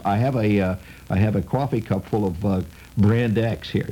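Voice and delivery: ordinary talk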